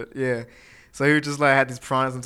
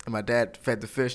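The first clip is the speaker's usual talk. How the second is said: ordinary talk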